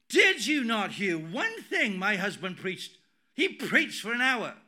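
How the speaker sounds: forceful mock rebuke; acted indignant scolding. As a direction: act out an outraged wife laying into someone. Wife's angry scolding, acted out.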